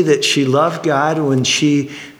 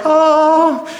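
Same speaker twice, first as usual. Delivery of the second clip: mock easy oh; 4 dB louder than usual. Easy birth mocked.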